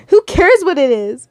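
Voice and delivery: mild shriek. A narrator's reading. Captive to the blackmailer, mild shriek. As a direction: shriek it mildly, cutting off his explanation. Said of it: "you mildly shrieked".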